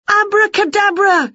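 high shouted magic word; shouted spell. Acted. Winnie shouts a spell at herself, high and loud. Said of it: "she shouted".

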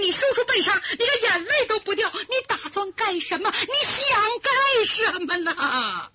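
high, crying shout of reproach; tearful accusation. Acted. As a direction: sob and rail at her coldness. Daughter accuses heartless sister.

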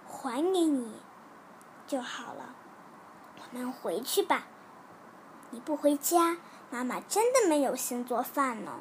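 soft, soothing mother voice; gentle comforting. Acted. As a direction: console a crying child softly. Mother comforting her crying child. (轻声温柔地安慰)

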